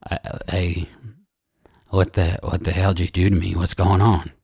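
low, groggy Keith coming round; dazed man just waking up. Acted. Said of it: "he looks kinda groggy".

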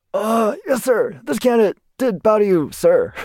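high, formal recruit reporting to an officer; imitated nervous candidate. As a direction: act out a rattled cadet answering up. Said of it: "And I'm like".

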